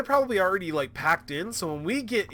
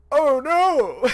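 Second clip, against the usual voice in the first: surprised oh no; 9 semitones higher, 7 dB louder than usual. Real, not acted. Surprised reaction to the game twist.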